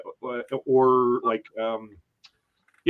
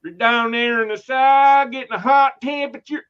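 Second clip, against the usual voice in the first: mock Southern accent; 8 semitones higher, 7 dB louder than usual. Teasing a friend from Georgia in a mock Southern accent. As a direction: play a Southern belle, louder and higher. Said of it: "the Southern accent".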